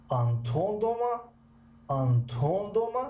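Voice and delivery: teacher contrasting where the question stress falls. Shown as a demonstration. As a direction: pitch the line up and ask about دوما. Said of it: "در بالای آنتون آمد".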